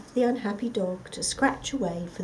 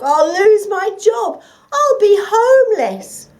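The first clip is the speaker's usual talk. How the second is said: much higher, loud, slow wail; despairing howl; 13 semitones higher, 16 dB louder than usual. Acted. The dog wailing about losing his job, much higher. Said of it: "howled the dog".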